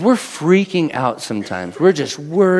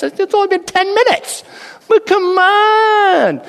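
put-on much higher, drawn-out child's whine; high whiny kid; slower than usual. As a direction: whine like a bored kid in the back seat. Kid voiced on road trip.

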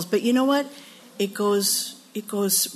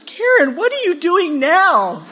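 high, loud startled mom calling out; mom catching her; 7 semitones higher, 6 dB louder than usual. Retold or imitated. Mom catching daughter burning bras, high startled call. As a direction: call out like a mother who found trouble. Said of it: "and she goes".

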